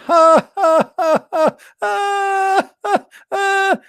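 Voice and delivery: high shrieking cackle; over-the-top mocking laugh; mock devil laugh. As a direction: cackle like a gleeful little devil. Shaitan laughing.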